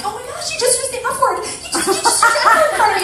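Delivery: high, fast, half-laughing mock outrage; all animated. Coach scolding a business partner over a banned word, wildly animated. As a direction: blurt it out in loud, playful shock. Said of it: "I get all animated".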